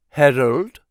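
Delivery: put-on lightly broken foreign accent; broken English accent. A narrator's reading. Woman interrupting Harold, in a lightly broken foreign accent. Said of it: "speaking English with a broken accent".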